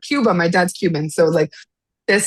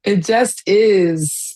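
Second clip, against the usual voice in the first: slow emphatic repeat; much slower than usual. Real, not acted.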